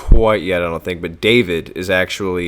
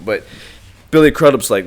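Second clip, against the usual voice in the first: normal talking voice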